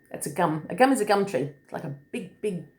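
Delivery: ordinary delivery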